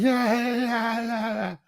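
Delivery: high, giggly mocking yeahs; mock her cackling agreement; mock Kamala cackle. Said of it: "she's cackling through it".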